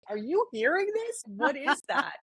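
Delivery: high, puzzled mock dog voice; a dog hearing a strange radio noise, high and puzzled; the dog's thoughts. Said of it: "the dog was really like".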